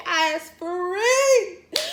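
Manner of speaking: high, slow, stretched out joy; squealing "I is free"; playful high voice. Real, not acted. Joyful playful exclamation.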